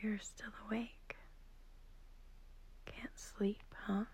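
whispering. Acted. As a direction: whisper so the sleeper beside you doesn't wake. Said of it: "I'm whispering because I don't want to wake up".

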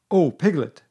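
mildly excited call to a friend. A narrator's reading. Pooh arriving with news for Piglet, excited. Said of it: "said Pooh excitedly".

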